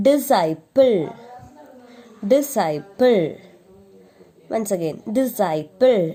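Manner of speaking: disciple said di-SIGH-pul, c silent; model pronunciation. Shown as a demonstration. Teacher modelling the word for repetition, clearly. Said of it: "the next one is".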